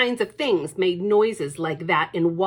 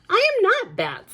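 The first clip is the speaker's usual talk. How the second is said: much higher, louder protest; indignant child; 13 semitones higher, 6 dB louder than usual. Acted. Lucy protesting to her brother. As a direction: protest hotly.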